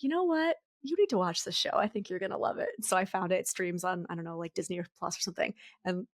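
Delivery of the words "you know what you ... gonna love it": a lightly lifted, eager mom voice; quoting herself to daughter. Retold or imitated. Mom urging her daughter to watch, mildly eager. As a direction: pitch up to pitch the show. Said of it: "I was like".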